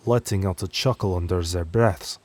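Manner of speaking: ordinary delivery, nothing marked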